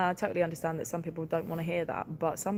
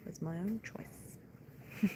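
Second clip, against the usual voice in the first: whisper; 2 semitones higher, 11 dB quieter, much slower than usual. Real, not acted. Wry aside to viewers.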